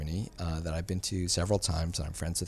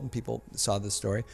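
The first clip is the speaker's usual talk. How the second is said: normal talking voice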